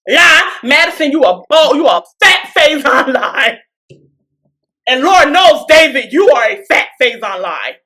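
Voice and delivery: loud, emphatic call-out; mock accusation. Recapper calling Madison and David liars, mock accusation. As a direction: call out each liar loudly and deliberately.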